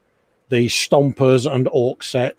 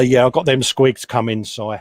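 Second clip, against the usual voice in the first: normal talking voice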